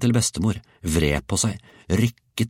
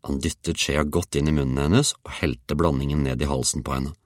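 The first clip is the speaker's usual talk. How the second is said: ordinary delivery, nothing marked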